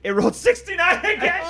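loud, high laughing announcement; cracking up as you shout it. Real, not acted.

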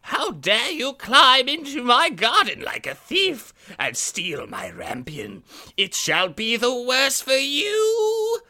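high, slow, furious witch screech; angry witch. Acted. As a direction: snarl it like a wicked old witch. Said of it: "as she cried, with angry eyes".

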